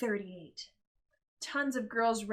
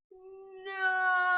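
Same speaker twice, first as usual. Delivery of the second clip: high, drawn-out wail; mother wailing as Miracle falls; 8 semitones higher, 3 dB louder than usual. Acted. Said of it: "Missus De La Creme wailed".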